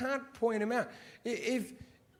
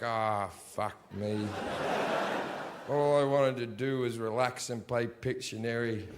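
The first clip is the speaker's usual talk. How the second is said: deflated groan; 7 semitones lower than usual. Retold or imitated. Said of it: "you're just like".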